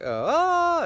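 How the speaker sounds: imitating a sudden high, loud yelp of pain; mock yelp of pain. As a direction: yelp like a cramp just hit. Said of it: "then you move and you're like".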